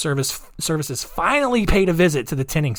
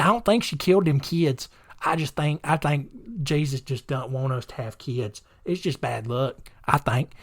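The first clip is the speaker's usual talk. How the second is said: put-on Southern accent; 2 semitones higher than usual. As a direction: imitate a clueless Southern husband. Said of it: "why he has a Southern accent".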